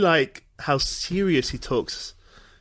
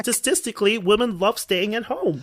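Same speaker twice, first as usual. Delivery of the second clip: Jordan Peterson impression; 7 semitones higher than usual. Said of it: "I just think of Jordan Peterson".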